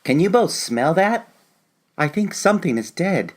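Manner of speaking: put-on a child sniffing at a foul smell, wary; young grandchild voice. Grandchild character in audio drama.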